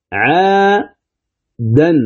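"عادا" split slowly into 'aa and dan; word sounded out. Shown as a demonstration. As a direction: stretch the first vowel of "عادا", then end on a clear n. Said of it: "لفظها".

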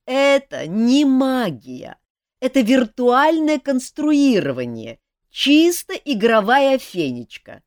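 weary jester explaining. A narrator's reading. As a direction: spell it out patiently. Жак объясняет устало. (медленно и громче, устало объясняя)

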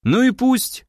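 high-pitched, offhand brush-off; dismissive. A narrator's reading. Another clone brushing off Sergey's worry, dismissive. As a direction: wave it away with a lifted voice. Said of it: "отмахнулся другой клон".

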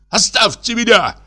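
a shriek that breaks out of him; shouting in a strange voice. A narrator's reading. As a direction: burst out, voice not his own. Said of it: "крикнул он не своим голосом".

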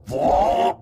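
high, loud screaming name; furious shout. A narrator's reading. Possessed Hùng screaming at Uncle Vó, high furious shout. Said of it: "nghiến răng nghiến lợi".

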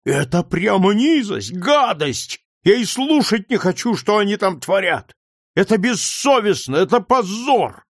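put-on a boy choking on sobs of fury at the injustice; indignant sobbing boy. A narrator's reading. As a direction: cry out against it, voice cracking with held-back sobs. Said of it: "рыдая и громко выражая своё негодование".

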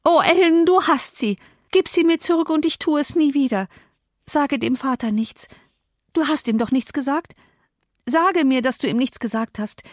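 put-on high-pitched girl begging; desperate pleading. A narrator's reading. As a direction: plead anxiously for it back. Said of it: "Sie warf sich auf die Knie".

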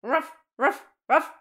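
частый высокий громкий лай; лай на дверь. A narrator's reading. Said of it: "рыча в сторону двери". (пёс Серкан лает на дверь)

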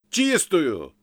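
loud, high one-word yell; emphatic overloud confirmation; shouted reply. A narrator's reading. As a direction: shout it as if half-deaf. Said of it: "крикнул я".

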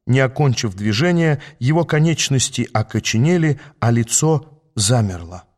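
plain reading voice

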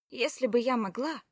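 plain reading voice

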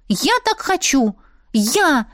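exclaiming. A narrator's reading. Friend insisting it's her own wish, exclaiming. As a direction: insist like a wilful girl. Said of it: "воскликнула брюнетка".

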